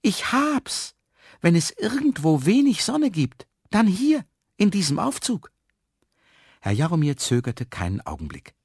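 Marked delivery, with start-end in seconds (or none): 0.0-5.5 high, louder eureka exclamation; excited cry. A narrator's reading. Trapped man hits on planting seeds, excited high cry. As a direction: cry out the bright idea, pitched way up. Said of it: "Und da rief er".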